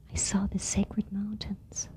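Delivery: slow whisper of wonder; awed whisper. Acted. Mouse telling Frog what he saw, slow awed whisper. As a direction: whisper it like a holy secret.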